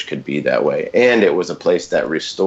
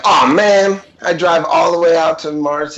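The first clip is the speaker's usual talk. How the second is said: exasperated groan; 11 semitones higher, 5 dB louder than usual. Retold or imitated. Annoyed pastor absent.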